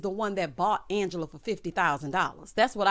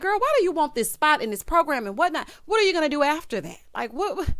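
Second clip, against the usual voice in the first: higher, louder, rushed disbelief; exasperated questioning; 8 semitones higher, 4 dB louder than usual. Pretend. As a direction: pepper her with questions. Quizzing a character, exasperated.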